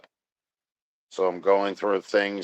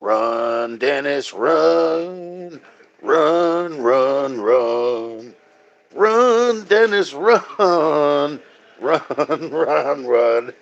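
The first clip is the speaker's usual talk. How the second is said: shouted mock chant, climbing higher; mock cheering; 5 semitones higher, 4 dB louder, much slower than usual. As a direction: chant it like egging someone on in a race. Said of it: "So I go".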